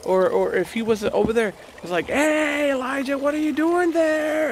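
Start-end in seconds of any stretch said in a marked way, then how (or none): 2.1-4.5 high, drawn-out call from afar; mock distant shout. Preacher imagining God calling Elijah from far off, mock shouting. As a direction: call out as if across a great distance. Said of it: "And screaming".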